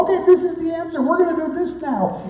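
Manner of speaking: hammed-up enthusiasm about a new idea; mock excited pastor. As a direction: play yourself fresh back from a conference, all fired up. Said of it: "I'd come back and go".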